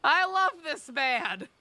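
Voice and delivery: high, loud, drawn-out gush; high-pitched fan outburst. Real, not acted. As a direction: gush loudly over your favorite, stretching the words. Gushing over a game character.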